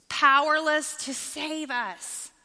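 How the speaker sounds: high, slow, stretched-out emphasis; emphatic proclamation. Real, not acted. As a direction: drive the point home, drawing out each word. Climax of point about idols.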